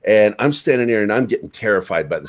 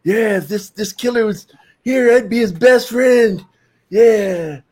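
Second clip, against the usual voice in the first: higher, slower pleading voice; sly deal with the killer; bargaining kid; 9 semitones higher, slower than usual. Retold or imitated. Boy watching a horror film bargaining with the killer, pleading. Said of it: "bargaining with the devil".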